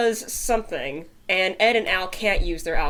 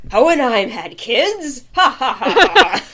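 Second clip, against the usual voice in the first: mocking laugh; 5 semitones higher, 8 dB louder than usual. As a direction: laugh it off loudly, disbelieving. Stranger mocking, laughing loudly.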